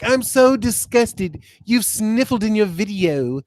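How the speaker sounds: very slow, stretched-out mock revulsion; mock disgust. Streamer imitating a viewer appalled by sniffling, in exaggerated disgust. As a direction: imitate a prissy complaint, dragging every word.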